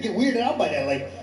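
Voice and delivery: ordinary delivery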